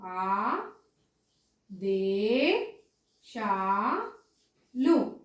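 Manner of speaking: ఆదేశాలు very slowly as ఆ, దే, శా, లు; ఆదేశాలు broken into drawn-out syllables. Shown as a demonstration. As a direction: dictate ఆదేశాలు with long pauses between syllables. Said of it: "స్పష్టంగా పలికితే".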